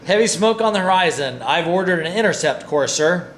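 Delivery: officer shouting the contact report; loud report. Acted. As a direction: report loudly to the captain. Officer reports over battle noise.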